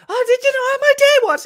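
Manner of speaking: put-on high, loud, rapid girlfriend squawk; mock chatty girlfriend. Girlfriend interrupting his meal, high mock chatter.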